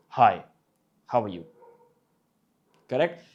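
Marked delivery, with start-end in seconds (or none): 0.1-1.5 deep, less authoritative. Shown as a demonstration. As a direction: say it deep but less commanding. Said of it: "the pitch was the same, but you felt the difference in tone".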